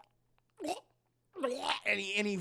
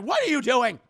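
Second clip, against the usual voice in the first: loud, raised outraged question; alarmed shout; 5 semitones higher, 9 dB louder than usual. Retold or imitated. Mom sees dad throw Anna, alarmed shout. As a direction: shout it in shocked protest.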